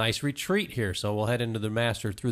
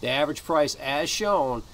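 pitchman price rundown; 3 semitones higher than usual. Acted. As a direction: sell it like a host announcing prices.

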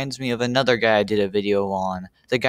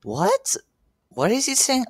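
baffled. Real, not acted. Baffled by the mumbled line.

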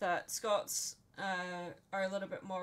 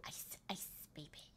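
soft, voiceless whisper; whispered catchphrase; 5 semitones lower, 15 dB quieter than usual. Acted. Teasing a friend about what they'll say, whispered. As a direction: whisper it like a hushed hook. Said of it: "waiting for you to go".

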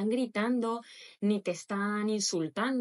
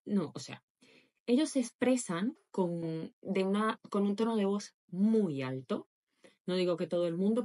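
normal talking voice

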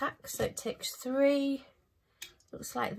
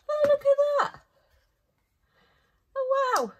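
much higher and louder, thrilled; delighted gasp; 9 dB louder than usual. Real, not acted. Delighted shriek when the light finally switches on. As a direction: burst out in real delight.

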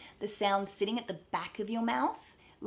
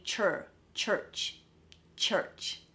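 "church" with a strong American R; American dialect; much slower than usual. Shown as a demonstration. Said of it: "with an American dialect".

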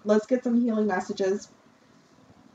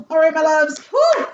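big high burst of relief, much louder; excited relief; 9 semitones higher, 10 dB louder than usual. Real, not acted. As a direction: let it all out after a long reading. Relieved outro after finishing reading.

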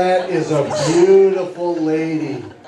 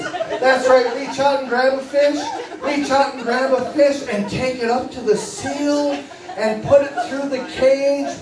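excited parade story; 5 semitones higher than usual. Acted. Hypnotist walking the six-year-olds through the zoo parade, bright and eager. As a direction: paint the parade like a thrilled children's entertainer.

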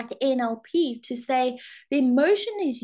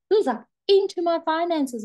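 higher and louder with a sharp gasp; mock shock at the finances; startled gasp; 7 semitones higher, 4 dB louder than usual. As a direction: recreate the moment of alarm. Panic as finances collapse.

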